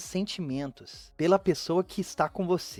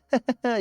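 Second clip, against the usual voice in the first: a short, higher nervous giggle; nervous laugh; 5 semitones higher than usual. Acted. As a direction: laugh it off, scared underneath. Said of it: "começa a rir toda nervosa". (risadinha nervosa)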